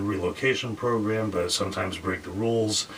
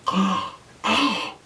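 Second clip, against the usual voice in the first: appalled gasp; 12 semitones higher than usual. Retold or imitated. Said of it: "They're just appalled".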